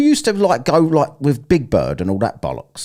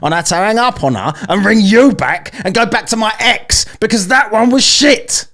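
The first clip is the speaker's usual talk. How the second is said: higher, louder annoyed complaint; worked-up rant; 11 semitones higher, 6 dB louder than usual. Real, not acted. Caller complains to the AI about the last version, worked-up rant. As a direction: let the irritation climb to a loud finish.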